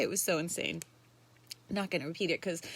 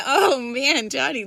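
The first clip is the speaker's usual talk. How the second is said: ordinary talk, nothing marked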